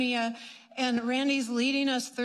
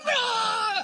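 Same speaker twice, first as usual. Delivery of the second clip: roaring shout; 10 semitones higher, 4 dB louder than usual. Retold or imitated. Breaking the crutches.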